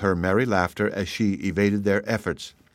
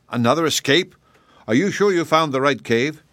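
angry; 6 semitones higher, 3 dB louder than usual. A narrator's reading. King shocked and angry.

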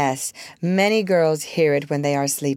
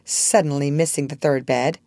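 ordinary delivery

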